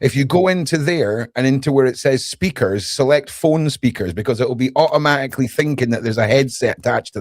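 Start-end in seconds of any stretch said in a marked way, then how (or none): none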